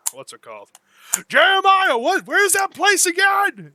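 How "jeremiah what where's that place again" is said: high, loud call across the bar. Acted. Bartender calling over to Jeremiah for help, loud and high. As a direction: shout the question to Jeremiah.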